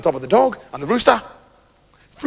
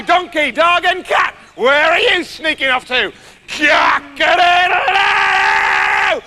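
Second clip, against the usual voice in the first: high, drawn-out shouted crow; loud, oblivious rooster waking everybody; 9 semitones higher, 4 dB louder, much slower than usual. Acted. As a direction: crow every word at the top of your voice. Plays the crowing rooster.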